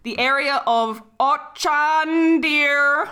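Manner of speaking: slow, drawn-out spooky read. Acted. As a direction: stretch the name eerily. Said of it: "spooky voice".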